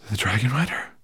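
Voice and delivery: plain reading voice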